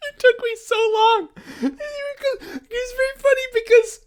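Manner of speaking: high, squeaky words through real laughter; helpless high-pitched giggling speech; laughing. Real, not acted. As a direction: barely get the words out for laughing. Helpless laughter while explaining.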